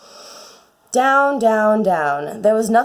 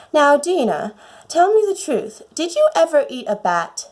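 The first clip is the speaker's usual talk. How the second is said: higher, earnest and gentle, asking her cat in a dream; sincerely asking Dinah for the truth; 6 semitones higher than usual. A narrator's reading. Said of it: "saying to her very earnestly".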